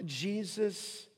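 ordinary delivery

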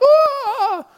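sudden high startled cry; a sharp high mock shriek of pain; child's yelp. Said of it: "They're freaking out".

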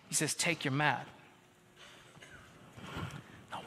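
hushed, tender preaching; quiet sermon. Acted. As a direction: drop down and speak it gently. Quieter reflective sermon stretch.

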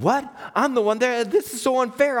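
high, incredulous complaint; acted-out aggrieved whine; indignant protest. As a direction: argue back as the wronged party. Acts own protest to God.

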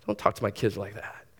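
quietly firm, a protector who needn't shout; a touch of calm protective dad. Pretend. Dad confronting stranger threatening his kids, low firm warning. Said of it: "full of confidence and saying".

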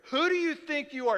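high, loud indignant challenge; confrontational. Acted. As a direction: throw it like a challenge. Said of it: "a confrontational tone".

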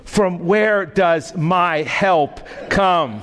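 slow, solemn reading of the psalm; measured pulpit reading of scripture. Acted. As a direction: lift the verse slowly and reverently. Reads the psalm text.

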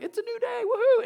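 put-on high, bursting child's cheer; cheery kid. Parent imitating a child greeting the day, high and gleeful. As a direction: squeal it like a carefree kid waking up. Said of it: "it's like".

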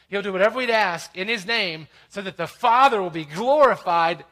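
high, loud pulpit delivery, key words punched; emphatic preaching. Real, not acted. Preacher explains answered prayer to congregation, emphatic preaching. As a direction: preach it with rising force.

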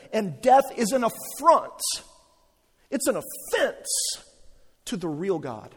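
fervent pulpit proclamation of God's care; preaching at full force. Acted. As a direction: build the sermon to a passionate peak.

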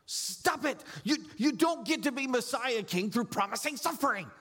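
Peter's rebuke. Acted. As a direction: Peter scolding his master, mildly voiced. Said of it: "He rebukes Jesus and he says".

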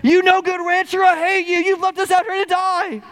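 put-on the boy screaming his anger even harder; harder angry yell. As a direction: push the shout to its peak.

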